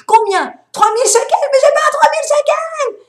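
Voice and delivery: high, loud desperate pleading; pleading driver. Retold or imitated. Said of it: "Il dit". (conducteur qui supplie le policier, aigu et fort)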